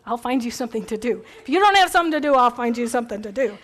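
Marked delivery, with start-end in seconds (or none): 1.4-3.6 bossy organizer. Acted. Speaker playing the busybody who fills your schedule, bossy. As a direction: hand out chores like the bossy organizer. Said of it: "People like me".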